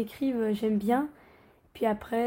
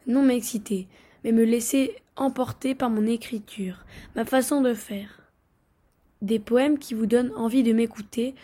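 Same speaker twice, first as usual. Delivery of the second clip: loud, declaimed reading of a poem; poetry recital; 6 dB louder than usual. Acted. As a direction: recite it line by line with deliberate pauses. Récite son poème sur scène. (récitation de poème, voix projetée)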